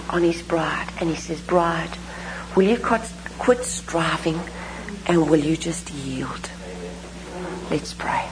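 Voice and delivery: low, soft, near-whispered appeal; gently plead, dropping almost to a whisper; hushed preaching. Acted.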